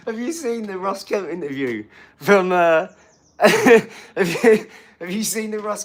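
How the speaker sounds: high, very loud, voice swamped by laughter; real laughing fit mid-sentence; laughing hard. Real, not acted. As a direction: fight to ask through the giggles. Cracks up retelling funny interview.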